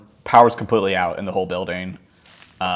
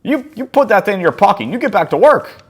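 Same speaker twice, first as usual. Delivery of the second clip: put-on higher, louder barking old geezer; bossy old man; 12 semitones higher, 8 dB louder than usual. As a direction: order the worker around like a grumpy boss. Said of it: "He had a real bitch attitude too".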